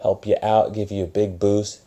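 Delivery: ordinary talk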